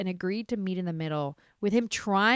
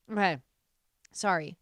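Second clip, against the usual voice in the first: normal talking voice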